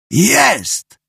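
startled yell from under the car. Acted. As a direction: shout it as you spot it. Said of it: "wrzasnął".

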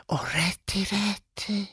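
soft, high, hushed gasp of wonder; awed whisper. A narrator's reading. Clown gazing open-mouthed round the toy shop, awed whisper. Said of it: "szepnął, rozdziawiając buzię".

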